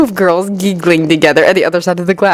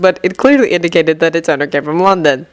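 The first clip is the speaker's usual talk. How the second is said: plain reading voice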